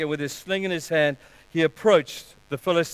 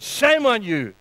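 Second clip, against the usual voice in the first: raised-pitch scolding; stern rebuke; 6 semitones higher, 4 dB louder, slower than usual. Acted. Jesus rebukes his disciples for shaming the boy, sternly. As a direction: rebuke them like a teacher. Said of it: "he would have said".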